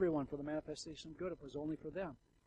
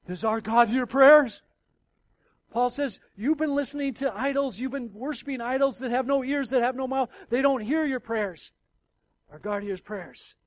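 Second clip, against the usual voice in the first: higher, much louder fervent preaching; preaching at full force; 9 semitones higher, 15 dB louder than usual. Acted. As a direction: thunder it from the pulpit.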